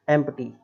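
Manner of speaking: "एम्प्टी" with audible P; P pronounced. Retold or imitated. Teacher showing the misreading, "एम्प्टी" with P sounded. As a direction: say "एम्प्टी", inserting P between M and T. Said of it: "पी लगाएंगे वहाँ".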